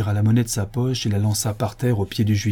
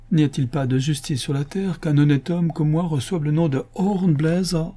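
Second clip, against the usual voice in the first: plaintive; 6 semitones higher than usual. A narrator's reading. Absalom se plaint.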